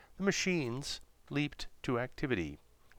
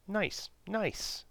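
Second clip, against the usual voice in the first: alien echo; 11 semitones higher than usual. Acted. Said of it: "echoed the alien".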